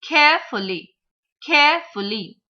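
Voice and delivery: CAREFULLY with CARE high, FULLY dropping away; first-syllable stress. Shown as a demonstration. Teacher modelling three syllabic words, first syllable stressed. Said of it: "stress on the first syllable".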